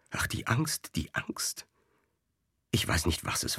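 soft, breathless whisper of dread; anxious whisper. Acted. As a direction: whisper it, still gripped by fear. Shaken servant recalls his fear. (angstvolles Flüstern)

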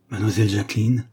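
hesitant second try in a changed voice; stammered restart. A narrator's reading. Shy neighbour stammering her full name again. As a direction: start again, still flustered. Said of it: "balbutia alors très troublé Roger".

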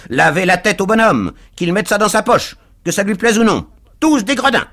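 loud, high bursts of short phrases; choppy, voluble stranger. A narrator's reading. Stranger orders the crowd about, loud and choppy. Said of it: "chapelet de ces phrases hachées".

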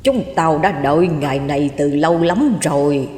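deep, dull spectral menace; low, muffled ghost voice. A narrator's reading. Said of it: "tiếng trầm đục, nghe như tiếng đài cassette".

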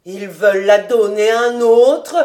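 loud, mildly desperate outburst; despairing interruption. A narrator's reading. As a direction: cut in with a touch of despair. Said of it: "interrompit Fritz désespéré".

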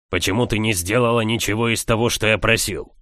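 ordinary delivery, nothing marked

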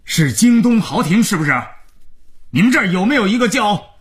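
high-pitched angry caller; irate man phoning to complain; indignant. A narrator's reading. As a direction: demand answers down the phone, furious. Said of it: "电话那头是个气愤的男生".

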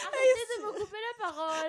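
laughing through a loud protest; giggling, half shouting. Real, not acted. Protests while laughing hard.

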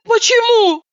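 high astonished question; surprised. Acted. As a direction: gasp out the question in amazement. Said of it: "изумилась я".

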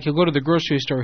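ordinary talk

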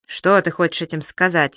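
usual speaking voice

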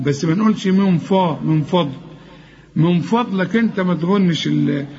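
normal talking voice

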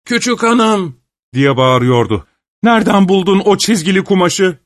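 high shouted call; deafening shout. A narrator's reading. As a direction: yell after a running woman. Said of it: "Kulakları sağır edici bir sesle".

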